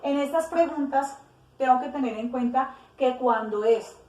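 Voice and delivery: ordinary delivery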